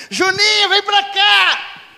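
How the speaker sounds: high, loud, drawn-out call across the street; neighbor yelling. Retold or imitated. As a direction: bellow the kid's name like a shrill neighbor. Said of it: "tanto que ela gritava". (grito esganiçado e arrastado da vizinha)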